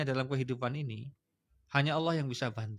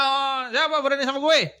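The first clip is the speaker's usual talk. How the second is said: louder and higher, a swaggering bully; arrogant challenge; 8 semitones higher, 9 dB louder than usual. Retold or imitated. Teacher imitating a proud person facing trouble, loud swagger. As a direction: puff up and challenge everyone, louder. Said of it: "kalau punya masalah".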